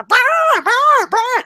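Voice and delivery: high-pitched shouted "bah" noises; mock alien screeches, very high; imitated alien attack noise. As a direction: blurt out high alien battle cries. Imitates aliens attacking people in street.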